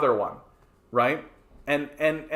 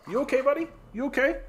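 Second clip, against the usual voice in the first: much higher, sing-song pet talk; talking to the dog; 11 semitones higher, faster than usual. Real, not acted. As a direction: coo at a worried dog. Talking to his panicking dog.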